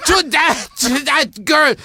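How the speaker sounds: put-on high, loud, outraged scolding in an older woman's voice; mock-horrified high yell; scolding shout. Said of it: "And she goes".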